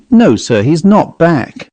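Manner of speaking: usual speaking voice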